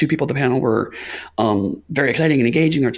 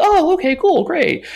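much higher and louder, chirpy relief; mock cheery relief; 5 dB louder, slower than usual. To the panelists after they mention Vampire, high relieved chirp. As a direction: brighten up, relieved it's good news.

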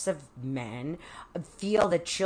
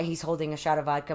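ordinary talk

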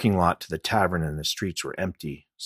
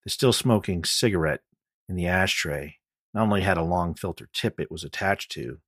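usual speaking voice

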